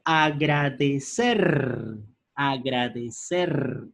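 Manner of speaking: agradecer drawn out very slowly, syllable by syllable; slow syllabified example. Shown as a demonstration. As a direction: spell agradecer out slowly so students hear each part. (agradecer, pronunciado muy despacio)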